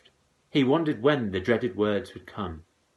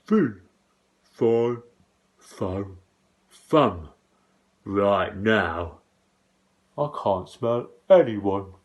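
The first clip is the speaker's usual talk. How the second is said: muttering giant; 6 dB louder, slower than usual. A narrator's reading. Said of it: "muttering loud".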